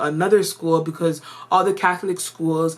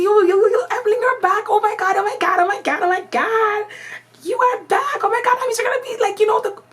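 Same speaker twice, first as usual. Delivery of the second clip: put-on much higher and louder, gushing kids; squealing excited kids; 4 dB louder, faster than usual. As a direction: act out kids freaking out that she's back, rushing it. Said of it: "So the kids are like".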